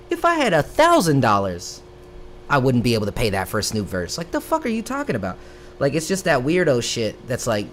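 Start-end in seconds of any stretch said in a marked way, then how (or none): 4.2-5.4 much higher, fast disbelief; reacting to an absurd rumor, incredulous. Real, not acted. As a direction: spit it out in total disbelief.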